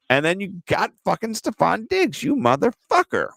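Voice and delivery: high, playful cursing at a friend; mock outrage. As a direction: needle your buddy with rising pitch. Mock outrage at a lineup pick.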